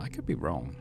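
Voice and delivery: normal talking voice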